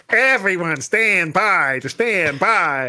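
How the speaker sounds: slow, put-on announcer in a mock Australian accent; hammed-up Australian accent, slowed right down; a half-baked Australian accent.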